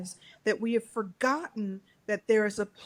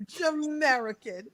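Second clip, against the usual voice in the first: put-on drawn-out Jamaican accent, pitched up; Caribbean accent; 7 semitones higher, much slower than usual. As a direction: stretch it slowly in a Jamaican accent. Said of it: "I go into my Caribbean accent".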